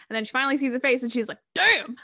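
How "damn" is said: high, loud punched exclamation; impressed exclamation. Retold or imitated. Painter finally sees her subject's face, impressed exclamation. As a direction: blurt it out, bowled over. Acting out Marianne seeing the face.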